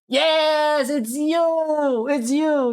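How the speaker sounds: high, drawn-out delighted cry; excited recognition. Real, not acted. Streamer spotting a familiar viewer, excited. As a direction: stretch each word out high and thrilled.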